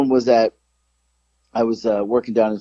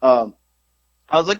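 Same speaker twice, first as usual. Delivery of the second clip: ordinary talk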